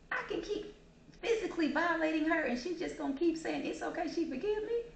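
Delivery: high, incredulous gloating; unrepentant attacker. Acted. Attacker realizing the victim will keep forgiving, gloating.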